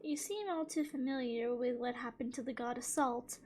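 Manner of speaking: ordinary talk, nothing marked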